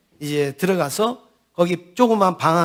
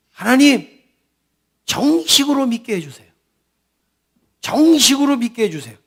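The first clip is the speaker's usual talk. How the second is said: higher, louder pleading prayer, retold; 4 semitones higher than usual. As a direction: cry out to God over and over. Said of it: "크게 막 기도를 하는 거예요". (큰 소리로 간절히 외치는 기도 흉내)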